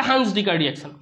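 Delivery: "হান্স" said with an open "aa" vowel; Hans, not Huns. Shown as a demonstration. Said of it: "আবার হুন্স ডিকার reaction বলিস না". ("হান্স" আ-কার দিয়ে উচ্চারণ)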